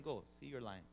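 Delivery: usual speaking voice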